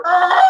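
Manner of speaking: high, dopey monster bellow; dumb dinosaur roar. Retold or imitated. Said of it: "dinosaur with a lobotomy going".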